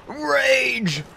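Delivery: high-pitched parody of raging; mock rage. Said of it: "raging and raging and raging".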